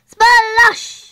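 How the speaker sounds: big loud splashy Splosh!; loud splash. Acted. Thieves fall into the duck pond, shouted splash sound.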